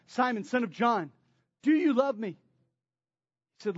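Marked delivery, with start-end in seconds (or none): 0.1-2.3 the third ask, louder and pressing; Jesus pressing Peter, louder and more urgent. Acted. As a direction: push the question harder, louder. Jesus asks Peter the third time.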